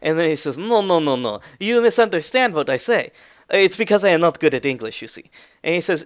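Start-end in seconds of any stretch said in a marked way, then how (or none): 0.6-5.3 put-on slightly high Russian-accented protest; Russian-accented excuse. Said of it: "a familiar Russian voice".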